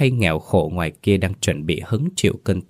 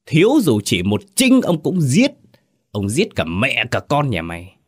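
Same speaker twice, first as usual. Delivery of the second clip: glare and threaten to kill them; menacing rage; 5 semitones higher than usual. Acted. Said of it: "Lão Kính trợn mắt".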